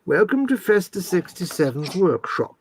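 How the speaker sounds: slow, put-on posh presenter; YouTube presenter voice. Shown as a demonstration. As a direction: announce it like a mock-posh tutorial host. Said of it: "it was your YouTube voice".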